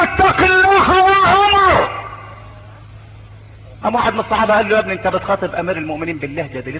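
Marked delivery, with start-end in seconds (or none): 0.0-1.9 high, slow, stern rebuke; bold rebuke. Retold or imitated. A man boldly rebuking the caliph, slow and stern. 4.7-6.8 quick, softer scolding; hushed reproach. Retold or imitated. A companion quietly scolding the man, hushed and quick.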